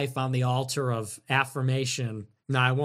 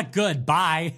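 ordinary talk, nothing marked

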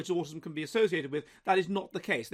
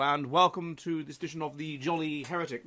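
ordinary talk, nothing marked

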